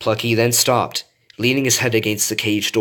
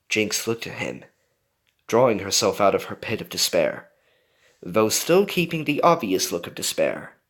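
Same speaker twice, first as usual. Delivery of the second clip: usual speaking voice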